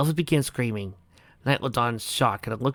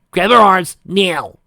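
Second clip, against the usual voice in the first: shouted order; 8 semitones higher, 11 dB louder, slower than usual. Acted. Rainbow orders friends.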